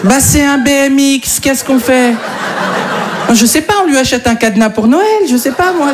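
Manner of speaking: high, laughing parent voice; dismayed parent. Retold or imitated. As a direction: voice the baffled parents. Parents' dialogue continued.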